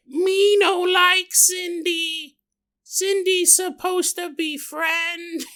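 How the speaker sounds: put-on high, slow, halting childish voice; a little kid talking like a caveman; broken-English little girl. Imitating niece Samantha in a slow, high broken-English voice. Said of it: "Who talks like that?".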